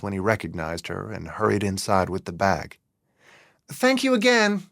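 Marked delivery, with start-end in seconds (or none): 3.7-4.6 much higher, calling across the sidewalk. A narrator's reading. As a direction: call your thanks to him as the cab leaves. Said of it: "Ellen called out to Charles".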